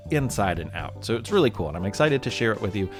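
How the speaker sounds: ordinary delivery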